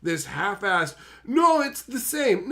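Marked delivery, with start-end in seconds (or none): 1.2-2.5 high, slow insistent whine; imitating a stubborn arguer; mock stubborn protest. Voicing the other side of quarrel.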